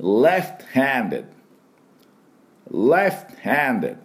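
repeat-after-me left-handed; model pronunciation. Shown as a demonstration. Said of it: "私の後について".

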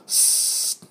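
long voiceless "sss" like air leaking; hissing S. Shown as a demonstration. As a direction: hiss like a punctured tyre tube. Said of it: "タイヤのチューブで針を穴を開けた". (空気が漏れるような「スー」)

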